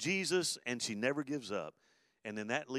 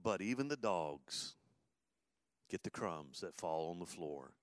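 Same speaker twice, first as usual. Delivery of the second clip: humble plea; 6 semitones lower, 6 dB quieter than usual. Retold or imitated. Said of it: "She agrees, said".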